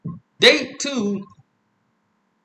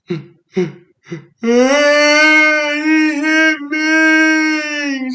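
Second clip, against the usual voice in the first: put-on high, loud, long drawn-out child's wail; mock crying; 12 semitones higher, 8 dB louder, much slower than usual. Five-year-old tattles to mom about a hit, hammed-up wail. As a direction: bawl it like a tattling kid, hammed up. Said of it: "Boy, stop crying".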